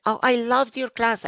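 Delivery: high-pitched gushing student; imitated gushing praise. Former student to her old teacher, gushing. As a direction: gush like an admiring former pupil.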